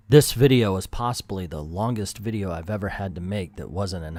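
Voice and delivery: low, subdued confession to the viewers; resigned, low-voiced admission, dying to a whisper; weary aside. Real, not acted. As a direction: drop out of the performance, low and soft. Weary aside to comment section.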